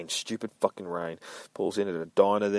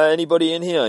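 high, louder call into an empty shop; raised voice calling into the store; 10 semitones higher, 9 dB louder, faster than usual. Acted. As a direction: call to anyone in the back room.

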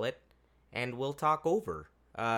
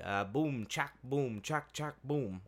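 beatbox imitation; 4 dB quieter, slower than usual. Imitating kids' techno beats.

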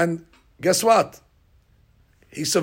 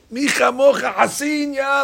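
1 exclaimed verse; 7 semitones higher than usual. Real, not acted.

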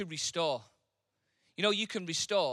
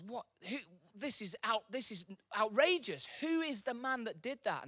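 a touch of outrage, pitched up; mild incensed outburst; 7 semitones higher than usual. Acted. King hearing of the stolen sheep, mildly outraged. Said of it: "He's incensed and goes".

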